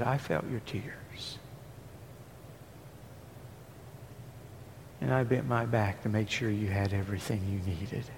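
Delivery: quiet voice of God. Acted. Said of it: "God said".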